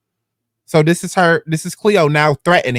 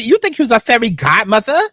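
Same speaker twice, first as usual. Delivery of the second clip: much higher, louder incredulous mockery; mocking disbelief; 6 semitones higher, 3 dB louder than usual. Real, not acted. Mocking a friend's text, in shrieking disbelief. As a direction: scoff at her like it's absurd.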